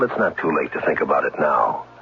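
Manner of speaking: usual speaking voice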